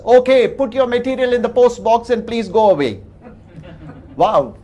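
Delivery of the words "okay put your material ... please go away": a curt, louder brush-off; brusque dismissal. Retold or imitated. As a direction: shoo them away through the speaker. Brusque reply from the intercom.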